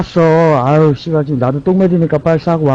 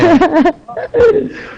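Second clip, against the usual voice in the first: sudden high laugh; high burst of laughter; 14 semitones higher than usual. Real, not acted. (높은 웃음 터뜨림)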